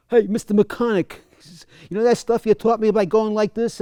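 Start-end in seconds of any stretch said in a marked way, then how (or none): 0.1-1.1 higher, louder Long Island greeting; Long Island accent. Retold or imitated. Former student hailing his old coach in a Long Island accent. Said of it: "inimitable Long Island accent". 1.9-3.8 put-on higher, louder Long Island accent, eager question. Student asking the coach about his lesson in a Long Island accent. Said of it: "inimitable Long Island accent".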